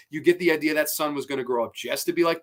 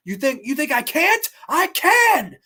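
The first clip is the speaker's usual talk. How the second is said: higher and louder, up to a shout on "I can!"; yelling husband; 11 semitones higher, 6 dB louder than usual. Retold or imitated. Husband yelling at his wife before hitting her, loud shout. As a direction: bellow it at her, rising to a scream. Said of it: "Yelling at her".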